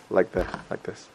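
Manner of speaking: whispering into a cupped hand; muffled whisper behind the hand; hand over mouth. Shown as a demonstration. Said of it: "Whisper into your hand like this".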